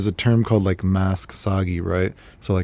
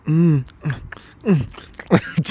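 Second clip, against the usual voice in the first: hummed "mm" sounds in a put-on voice; a coy, teasing murmur; mock playful hum; 5 semitones higher, much slower than usual. Drunk girl on the train, coy hummed murmur.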